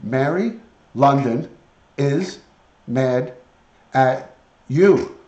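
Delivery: each word spaced and punched. Acted. Telling the parrot Mary London is mad, each word separate. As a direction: spell it out slowly to the bird.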